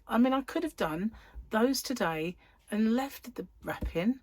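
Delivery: ordinary delivery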